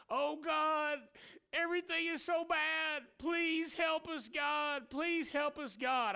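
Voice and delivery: high, pleading wail; mock desperate prayer. Crying church begging God for help in a high wail. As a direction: beg God in mock despair.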